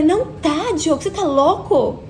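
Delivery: exasperated outburst, short of a full shout; retold yelling. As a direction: re-enact an agitated scolding. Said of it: "Comecei a gritar dentro do elevador".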